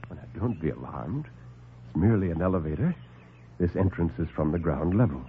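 low, soft, smooth doctor; calm the men down, unhurried and superior; suave doctor. Acted. Doctor soothes the alarmed lieutenant, low and suave.

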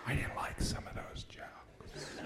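hushed, slow aside; whispered aside. Acted. Comic whispering to the audience about God. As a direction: lean in and whisper it.

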